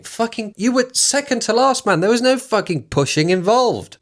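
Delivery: high, exasperated scoff. Real, not acted. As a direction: pour scorn on the word pushing. Mocking the quote, exasperated.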